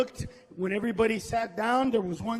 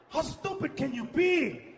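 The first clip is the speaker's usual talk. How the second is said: usual speaking voice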